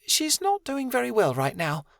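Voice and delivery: high, lightly voiced gentle Martin; mumbling Martin. A narrator's reading. Martin warning Angel to step back, mumbled. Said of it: "Martin mumbled".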